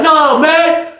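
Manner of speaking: high loud furious rant; fed up and yelling; angry rant. Retold or imitated. Old head ranting about newcomers, high yell.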